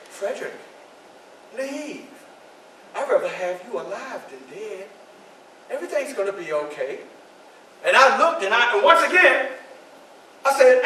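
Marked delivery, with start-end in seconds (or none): 0.1-7.0 soft pleading. Acted. Wife calmly urges him to go.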